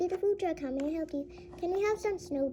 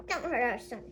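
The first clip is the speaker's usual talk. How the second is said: ordinary talk, nothing marked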